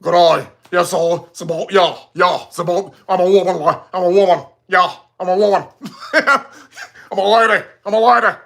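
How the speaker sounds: hammed-up mock Australian; broad, comic Australian impersonation; deep Australian accent. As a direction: imitate a thick Australian accent. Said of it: "had a deep, deep Austra".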